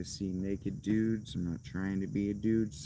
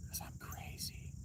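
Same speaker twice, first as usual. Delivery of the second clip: whispered; 17 dB quieter than usual. Pretend. Confiding to viewers, whispered. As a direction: whisper it like a secret. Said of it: "whisper aside".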